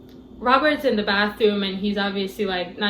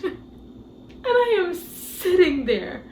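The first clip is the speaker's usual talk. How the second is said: stunned disbelief; 6 semitones higher, slower than usual. Real, not acted. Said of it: "with my mouth open".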